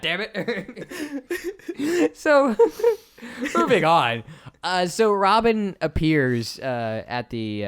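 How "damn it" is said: mock outraged wizard. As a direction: squeal over the stolen sandwich. Wizard outraged over sandwich.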